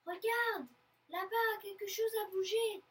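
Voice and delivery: high, loud, excited call; sudden exclamation. A narrator's reading. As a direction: exclaim as you spot movement. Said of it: "s'exclame soudain le père".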